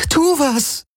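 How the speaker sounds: very high-pitched helpless plea; desperate cry. A narrator's reading. Kirai begs for action, desperate cry. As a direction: cry out, pitch leaping right up.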